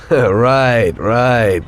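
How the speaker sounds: loud amused agreement; laughing shout. Acted. Driver laughing loudly at the mechanic's joke. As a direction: laugh it off at full voice.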